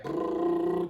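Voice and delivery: low, quiet lip-trill motor rumble; car engine noise. Retold or imitated. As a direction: imitate a car driving along with a soft brrr. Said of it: "that's the sound of our car".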